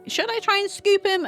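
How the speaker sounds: squeaky high voice asking herself; a hint of a timid inner voice. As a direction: pitch the thought way up, like a nervous inner voice. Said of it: "I was like".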